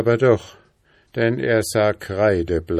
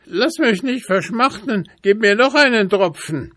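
high, louder raven begging; pleading raven; 11 semitones higher, 4 dB louder than usual. A narrator's reading. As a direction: plead for one more drop. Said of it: "Antwortete der Rabe". (hohe, flehende Rabenstimme)

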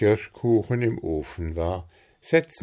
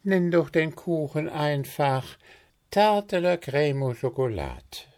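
higher, lightly voiced helpful friend; offering an idea eagerly, a touch higher; Willi chiming in; 9 semitones higher, 3 dB louder than usual. Friend offers a cake name, lightly voiced higher.

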